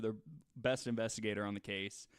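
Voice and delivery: usual speaking voice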